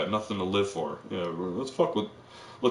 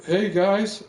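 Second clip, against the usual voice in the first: calling out; 11 semitones higher, 5 dB louder, much slower than usual. Retold or imitated. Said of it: "the guy's like".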